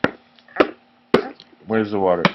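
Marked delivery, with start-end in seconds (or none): 0.0-0.7 whispered echo. Pretend. Parent whispering back to the child's guess.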